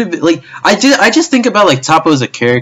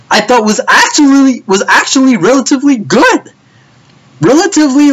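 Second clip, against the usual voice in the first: emphatic; 12 semitones higher, 3 dB louder than usual. Real, not acted. High loud emphatic rant.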